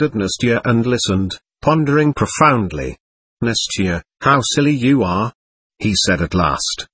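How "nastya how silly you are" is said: usual speaking voice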